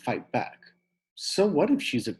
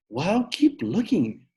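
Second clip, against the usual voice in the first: yelled; 8 semitones higher, 4 dB louder, slower than usual. Acted. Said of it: "I yelled".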